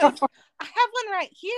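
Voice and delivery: gushing high Southern drawl, far above her own voice; cute Southern drawl. Retold or imitated. Church girl gushing over her tattoos in Southern drawl. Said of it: "in their little cute Southern drawl".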